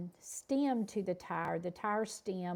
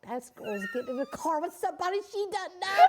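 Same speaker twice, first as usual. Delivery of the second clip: alarmed inner voice; 11 semitones higher, 7 dB louder, faster than usual. Retold or imitated. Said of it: "It's like".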